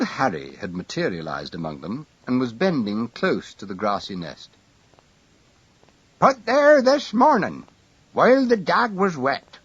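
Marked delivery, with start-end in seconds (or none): 6.2-9.6 put-on high, loud old man voice; a tiny old man piping up; small elderly voice. A narrator's reading. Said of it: "A small, elderly voice".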